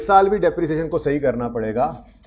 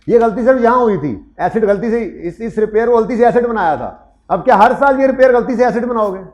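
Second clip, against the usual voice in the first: emphatic; 8 semitones higher, 6 dB louder than usual. Real, not acted. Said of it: "teacher stresses point, rising force".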